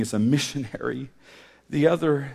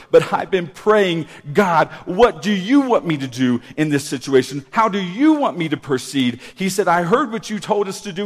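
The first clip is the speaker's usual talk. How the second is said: loud, insistent retelling of a testimony; raised voice, punched key words; impassioned preaching; 7 semitones higher, 9 dB louder than usual. Retold or imitated. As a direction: preach the husband's words with force.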